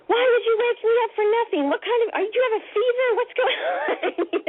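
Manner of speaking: high-pitched mock scolding; pissed-off mother. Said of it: "she got pissed off at me".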